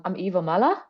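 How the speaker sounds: usual speaking voice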